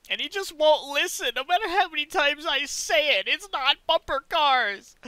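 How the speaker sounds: loud, high exasperated yell; exasperated shout. Real, not acted. Exasperated at teammate.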